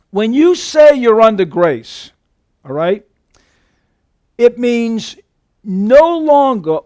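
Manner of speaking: slow, loud, driving the point home; emphatic preaching. Acted. Preacher to his congregation, driving home under grace, slow and loud. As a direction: preach it at full force.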